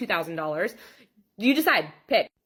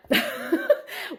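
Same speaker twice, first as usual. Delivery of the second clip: incredulous laugh. Real, not acted. Laughing at client's demand.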